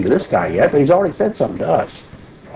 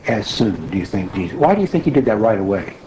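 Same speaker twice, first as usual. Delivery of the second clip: ordinary delivery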